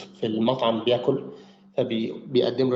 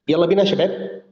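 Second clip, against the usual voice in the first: calling out; 5 semitones higher, 5 dB louder than usual. Real, not acted. Teacher rallying class back.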